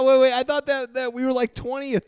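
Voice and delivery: wait, that can't be right, pitch way up; disbelieving. Real, not acted. Fan protesting the ranking to his co-host, high and loud.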